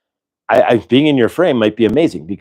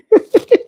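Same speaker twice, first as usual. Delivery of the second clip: burst of laughter; 4 dB louder than usual. Real, not acted. Laughs at chat joke.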